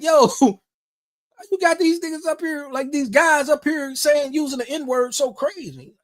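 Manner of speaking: retold exasperation, slow and high-pitched; pissed off. Complaining to cousin about guys using the word, retold exasperation. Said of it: "I'm getting pissed off".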